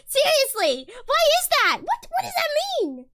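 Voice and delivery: high, loud exasperated questions; baffled outburst. Real, not acted. Ranting about German accents, baffled outburst. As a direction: demand an answer, voice leaping up.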